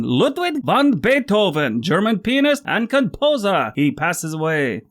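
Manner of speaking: put-on a deliberately terrible German accent for Beethoven; bad German accent. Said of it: "That was the worst German accent ever".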